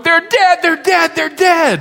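put-on very high, loud wailing child voice; hammed-up child crying out in alarm; panicked little girl. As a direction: imitate a frantic daughter shrieking the news. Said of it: "Addie is going".

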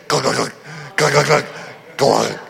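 low glugging noise in short bursts; imitated engine sputter. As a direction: imitate a van choking to a stop. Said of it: "the van goes".

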